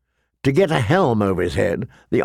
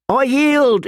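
high, shrill panicked cry; shrill squeal; 13 semitones higher, 3 dB louder than usual. A narrator's reading. Beaten fat boy begs to yield, shrill high cry. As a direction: squeal it out in terror. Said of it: "he shrilled".

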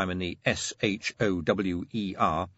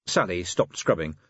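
usual speaking voice

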